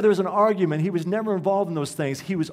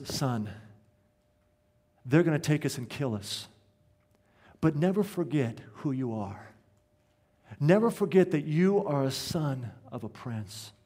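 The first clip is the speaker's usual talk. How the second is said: solemn fatherly farewell. Retold or imitated. Father kneels to his son before death, solemn and tender.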